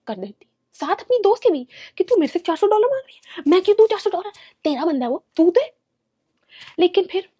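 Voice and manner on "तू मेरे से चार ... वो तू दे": imagined angry rant. Retold or imitated.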